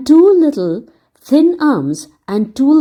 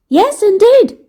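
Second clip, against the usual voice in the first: put-on much higher, louder eager boy; eager cry; 12 semitones higher, 5 dB louder than usual. Dick answering Humpty Dumpty, eager cry. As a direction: jump in eagerly to help. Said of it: "cried Dick eagerly".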